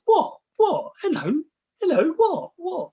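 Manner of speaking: high, bright puzzled "what? hello?"; very high questioning calls to a barking dog; sing-song high calls to disarm a dog; playful calls to a dog. Retold or imitated. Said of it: "if you sort of go".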